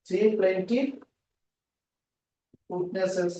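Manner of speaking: halting phrases with long gaps; dictation. Shown as a demonstration. Teacher giving students witness wording to write, halting dictation. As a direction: dictate piece by piece, waiting for writers.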